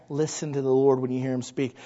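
ordinary talk, nothing marked

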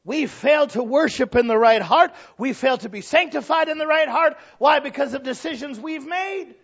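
high, loud, impassioned pulpit cry; full-force preaching. Real, not acted.

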